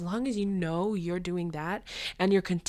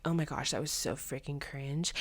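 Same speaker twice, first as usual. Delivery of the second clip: mock hater; 4 semitones lower, 8 dB quieter than usual. Mocking hater comment.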